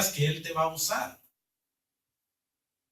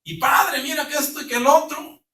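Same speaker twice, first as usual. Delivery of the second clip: mock whiny complaint, much higher and louder; complaining; 8 semitones higher, 3 dB louder than usual. Pastor retelling how he complained to God about money, much higher mock whine. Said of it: "empecé a quejarme con él".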